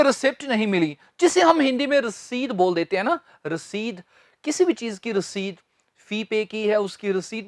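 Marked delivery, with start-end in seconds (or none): none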